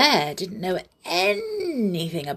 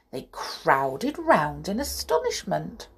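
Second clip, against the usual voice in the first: usual speaking voice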